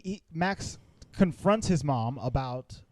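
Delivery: usual speaking voice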